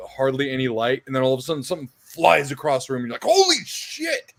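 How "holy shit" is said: panicked jump-scare yell. Retold or imitated. Player startled by a monster, high panicked yell. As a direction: scream for the chain gun in panic.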